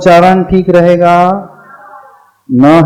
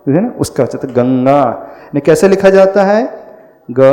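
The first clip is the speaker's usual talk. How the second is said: usual speaking voice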